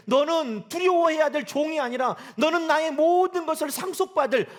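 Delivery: booming voice of God. Pretend. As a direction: speak as God, calling his child. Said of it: "하나님은 우리에게 뭐라고 말씀한다는".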